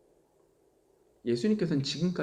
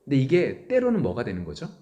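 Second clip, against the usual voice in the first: normal talking voice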